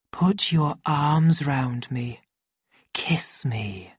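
slow seductive murmur sinking to a whisper; sleepy whisper. A narrator's reading. Lenina pressing close for a kiss, sleepy sinking whisper. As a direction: let it sink drowsily into breath. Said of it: "let her voice sink into a sleepy whisper".

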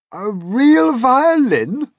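high, loud, slow astonished cry; surprised cry. A narrator's reading. Old grasshopper startled by James's words, crying out high and slow. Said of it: "the old green grasshopper cried".

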